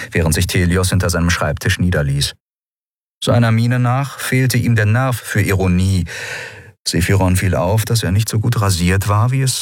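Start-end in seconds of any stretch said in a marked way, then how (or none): none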